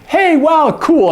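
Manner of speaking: high, excited hey wow; admiring wow. Acted. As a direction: react to a friend's new dress. Acting admiring a new dress.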